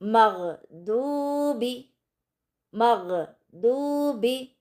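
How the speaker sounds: मग़दूबी drawn out very slowly, मग़ heavy and full; recited slowly. Shown as a demonstration. Teacher recites मग़दूबी slowly for practice. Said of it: "मग़ में गैन को मोटा और ऊंचा पढ़ेंगे".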